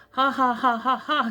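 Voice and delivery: loud, high braying laugh like a donkey; donkey-like hee-haw laughter. A narrator's reading. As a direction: honk out a stable-animal laugh. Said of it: "making a sound that wouldn't have been out of place in a stable".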